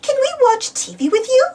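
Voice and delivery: put-on high little-girl piggy voice; a young pig asking sweetly to play; Peppa voice. A narrator's reading. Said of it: "said Peppa".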